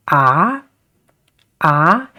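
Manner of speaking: "ah" rising like a question; second tone. Shown as a demonstration. Teacher demonstrating the uphill tone, rising "ah". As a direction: glide "ah" upward, as if asking. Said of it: "it is a uprising or uphill".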